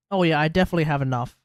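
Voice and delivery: ordinary talk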